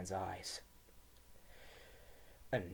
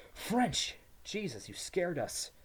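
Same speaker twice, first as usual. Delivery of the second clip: high, loud startled cry; startled outburst; 9 semitones higher, 5 dB louder, slower than usual. A narrator's reading. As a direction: blurt it out in relief and shock. Startled twin greets returning French.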